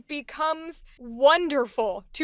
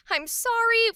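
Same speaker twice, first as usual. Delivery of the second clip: high-pitched, adorable little sorry; charming apology; 9 semitones higher than usual. Acted. She brings coffee and a snack, charming apology. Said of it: "This is charming".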